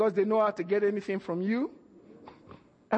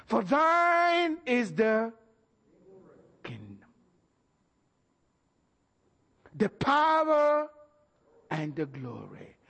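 1 solemn recitation; slower than usual. Acted. Recites doxology slowly.

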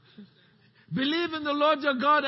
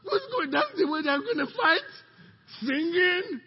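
high incredulous voice; enemy soldier in disbelief; mock puzzled enemy; 6 semitones higher than usual. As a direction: sound baffled at singers going to war. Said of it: "the army say".

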